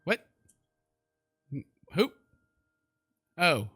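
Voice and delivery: normal talking voice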